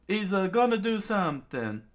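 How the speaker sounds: high small reindeer voice; high nervous creature voice; Chopper voice. Acted. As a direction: squeak it, worried.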